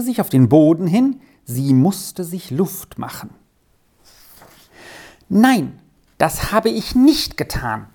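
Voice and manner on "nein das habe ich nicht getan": put-on high, louder outburst of a child; loud in agitation. A narrator's reading. Girl venting alone behind the hedge, loud and upset. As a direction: blurt it out, upset. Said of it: "kam vor Aufregung ganz laut heraus".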